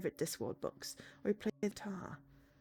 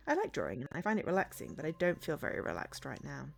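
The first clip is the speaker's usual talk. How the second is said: ordinary delivery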